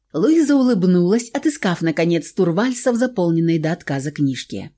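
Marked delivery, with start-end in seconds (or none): none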